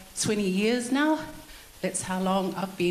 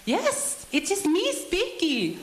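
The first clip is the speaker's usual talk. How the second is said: very high, slow mother-in-law impression; high-pitched voice; 11 semitones higher, slower than usual. Mother-in-law answering a prank call, high-pitched voice. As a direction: imitate her answering in a drawn-out, high voice. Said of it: "Viva with her high-pitched voice".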